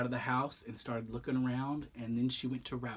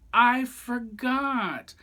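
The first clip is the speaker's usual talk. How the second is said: high, slow dismay; 10 semitones higher, 7 dB louder, much slower than usual. Acted. Goose finds the TV broken.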